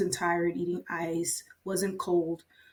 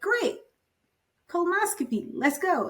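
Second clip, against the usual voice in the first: much higher, upbeat go-ahead; eager; 11 semitones higher than usual. Retold or imitated. Patient hears good endoscopy news and eagerly moves on, much higher. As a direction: cheer it on.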